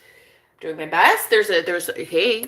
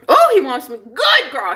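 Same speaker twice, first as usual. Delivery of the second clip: higher, louder gush; excited outburst; 4 semitones higher, 5 dB louder than usual. Real, not acted. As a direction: burst out with sudden delight. Bursts out with the same words.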